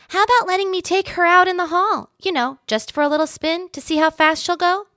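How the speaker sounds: high, bright, eager little mouse asking a favor. A narrator's reading. Ralph the mouse asks eagerly.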